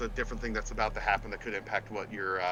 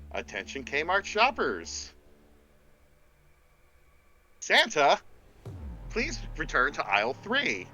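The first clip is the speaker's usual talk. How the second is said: store PA announcer; 3 semitones higher than usual. Acted. Game master pages Santa to aisle three, PA announcer. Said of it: "over the P A system".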